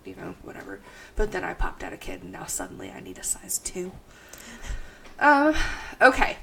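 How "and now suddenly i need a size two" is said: normal talking voice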